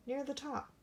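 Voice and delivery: ordinary delivery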